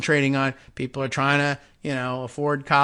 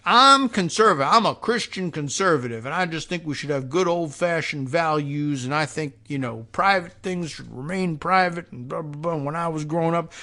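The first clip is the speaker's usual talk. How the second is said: mock Southern accent. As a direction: put on a Southern accent to lampoon a moralizing lawmaker. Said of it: "I'm giving them Southern accents".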